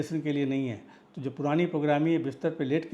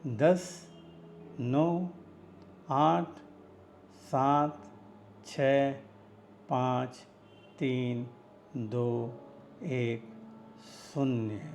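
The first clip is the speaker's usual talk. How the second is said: hypnotic countdown; 2 semitones lower, 3 dB quieter, much slower than usual. Acted. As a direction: count down slowly with long pauses, easing softer toward zero. Counts listeners down into relaxation. (सम्मोहक उलटी गिनती, धीरे-धीरे उतरती आवाज़)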